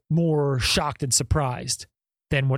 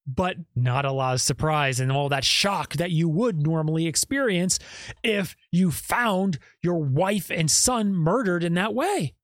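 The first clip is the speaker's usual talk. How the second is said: normal talking voice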